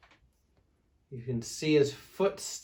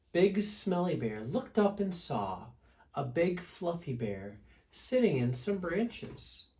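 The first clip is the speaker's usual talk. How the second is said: ordinary delivery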